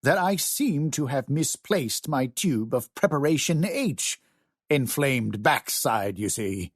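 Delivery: thick mock British accent. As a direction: lay on a heavy British accent. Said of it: "using a thick British accent".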